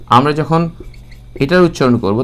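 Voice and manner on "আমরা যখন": usual speaking voice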